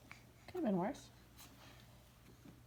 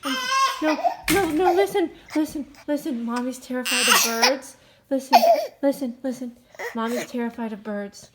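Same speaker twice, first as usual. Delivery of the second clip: higher, laughing reassuring voice; 6 semitones higher, 8 dB louder than usual. Real, not acted. Comforting crying baby.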